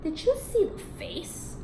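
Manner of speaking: slow, breathy whispered jeer; poodle's mocking whisper. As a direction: whisper it as a snide aside. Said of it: "A pampered poodle chuckled".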